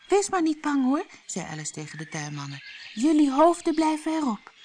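put-on high girl's voice, comforting; a kind child calming frightened servants; reassuring girl. Alice reassures the gardeners, high and comforting.

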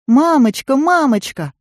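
put-on high child's cry for her mother; girl calling out. A narrator's reading. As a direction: call out for mommy, high and urgent. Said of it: "закричала Дебби".

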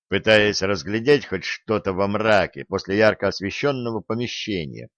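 ordinary delivery, nothing marked

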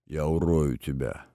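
low, hushed hoarse threat. A narrator's reading. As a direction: rasp out a threat, low and soft. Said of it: "прохрипел динамик". (хриплая угроза, низко и тихо)

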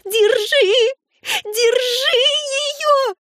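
extremely high, frantic screech; shrieking. A narrator's reading. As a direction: scream the command in a sustained squeal. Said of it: "Энн завизжала ещё громче". (отчаянный визг, очень высоко)

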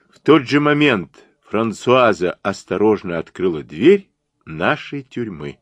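plain reading voice

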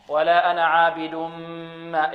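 عابد ما fused into a long nasal m; recited merge with held m in عابد ما; idgham. Shown as a demonstration. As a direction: melt the end of عابد into a hummed ما. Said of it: "إدغام".

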